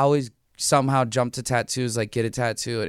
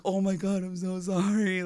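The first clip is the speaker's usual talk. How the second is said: higher, softened, drawn-out apology; mock sympathetic apology; 4 semitones higher, 5 dB quieter than usual. Onlooker apologizing to a hurting client, mock sympathetic. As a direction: imitate someone gushing an apology, slowly.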